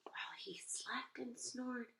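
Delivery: whispered so as not to wake him; hush, he's sleeping; soft bedtime whisper. A narrator's reading. Sleeping bear.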